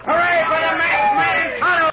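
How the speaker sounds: loud happy cheer. Acted. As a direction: cheer for the hero. Crowd cheers.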